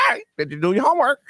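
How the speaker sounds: high, quick parrot squawk; parrot mimicking a parent. Host's parrot repeats what he says at home, squawky. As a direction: squawk it fast like a nagging parrot. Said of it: "the parent go".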